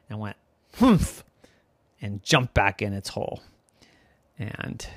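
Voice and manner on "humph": short disdainful grunt; evil crab humph. Acted. Evil crab snubbing the boy, scornful humph. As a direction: scoff like a grumpy crab. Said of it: "had an evil frown".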